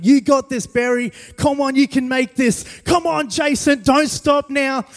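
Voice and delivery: high, loud coach cheering a runner on; encouraging shouts. Retold or imitated. Mates cheering him up the hill, loud and high. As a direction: pump them up, don't let them quit. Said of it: "he was encouraging".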